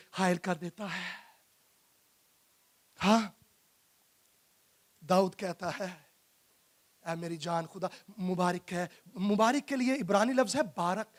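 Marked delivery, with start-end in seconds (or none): 0.1-8.9 low, soft, sober aside; hushed reflection. Acted. Preacher quoting David to the congregation, low and hushed. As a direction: drop right down, low and quiet.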